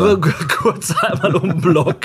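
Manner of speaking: joking reply through laughter; laughing. Real, not acted. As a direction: laugh while you say it. Joking about walking the dog.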